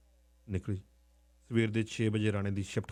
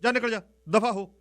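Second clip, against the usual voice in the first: higher and louder, get out; angry uncle shouting the niece out; 10 semitones higher, 7 dB louder than usual. Retold or imitated. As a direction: bark her out of the house. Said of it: "ਮੈਂ ਕਿਹਾ".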